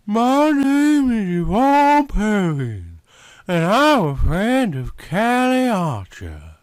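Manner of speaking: a slow Southern introduction, every vowel stretched; southern drawl. A narrator's reading. Yvonne introduces herself to Andrew in a Southern drawl. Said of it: "her thick southern drawl".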